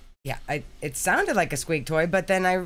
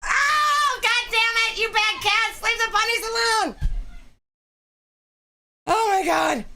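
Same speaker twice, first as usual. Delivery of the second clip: very high, loud shouts at the cats; screaming at the bad cats to leave the bunny alone; frantic yelling; 4 dB louder than usual. Real, not acted. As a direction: yell at them in real alarm.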